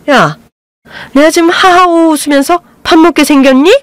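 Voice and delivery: high, shrieking outburst; mother-in-law shrieking across the private room; yelling. A narrator's reading. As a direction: blow up at the whole table. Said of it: "시어머니의 고성이".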